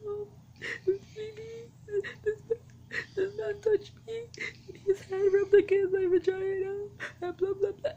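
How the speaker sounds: very high-pitched mock bawling; imitating a sobbing child, high and loud; tearful child. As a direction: wail to mom in a squeaky, crying little-kid voice. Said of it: "I'm in tears now".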